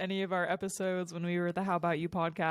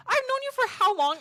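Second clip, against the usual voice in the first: high, loud mock disbelief; mock outrage; 7 dB louder than usual. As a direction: act scandalized at a friend. Retold outrage at friend.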